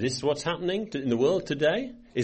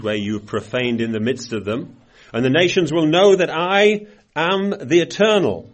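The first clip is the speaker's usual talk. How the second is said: scripture reading; 4 dB louder than usual. Acted. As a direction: let each phrase of the verse land. Reads scripture aloud.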